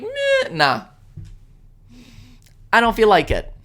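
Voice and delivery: a sudden high "Meh, nah", then a lazy shrug; lazy dismissive mock. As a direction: mimic politicians who can't be bothered. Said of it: "they're like".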